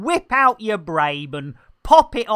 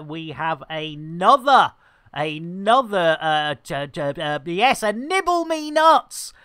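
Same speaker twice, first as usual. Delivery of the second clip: ordinary delivery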